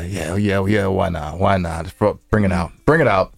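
normal talking voice

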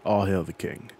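ordinary talk

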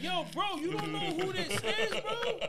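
high, loud, drawn-out disbelief; incredulous shout. Retold or imitated. Fan questions the clerk about the rapper, incredulous shout. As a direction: yell it like you can't believe him.

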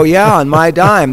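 proudly hammed-up loud retort; eager loud offer. Retelling his eager offer to city.

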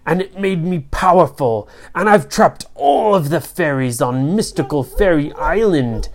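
theatrical storybook villain; evil tiny sorcerer, boastful. Acted. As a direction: gloat over your prisoners.

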